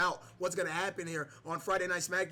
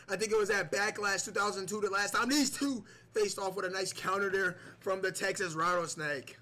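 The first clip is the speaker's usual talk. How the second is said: normal talking voice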